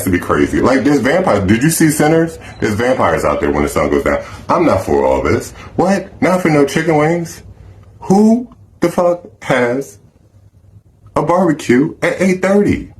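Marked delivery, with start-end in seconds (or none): 5.8-12.9 higher pitched disbelief; can't believe what he's hearing; high-pitched outrage at the invite; incredulous. Real, not acted. Ranting about late barbecue.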